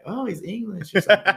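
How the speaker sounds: high, loud mock amazement; exaggerated excited whisper-to-neighbour reaction, voiced aloud; impressed passengers. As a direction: imitate passengers marvelling. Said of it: "And everyone's like".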